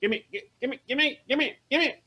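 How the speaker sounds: high, rapid-fire demand; grabbing voice coming up. Acted. Mother butts in to seize the radio, demanding. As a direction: pester in a high little voice. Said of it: "this voice kinda coming up, going".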